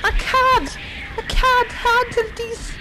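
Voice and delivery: panicked yelling. Real, not acted. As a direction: beg it to stop in panic. Jump scares in game.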